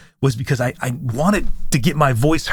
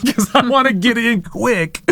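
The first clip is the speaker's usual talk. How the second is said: acted urgency; 9 semitones higher than usual. Laughs, acting her urgency to speak.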